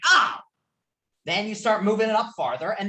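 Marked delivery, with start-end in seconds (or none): 0.1-0.4 short, high, loud yelp; imitated pain cry. Lecturer imitates the shocked learner, short pained cry. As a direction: let out a sudden mock zap yelp. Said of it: "the person's going like".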